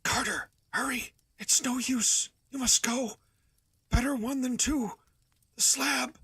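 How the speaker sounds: plain reading voice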